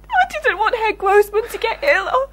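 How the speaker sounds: tearful apology, pitched well up; crying. Acted. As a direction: sob out the apology. Assistant breaks down apologising.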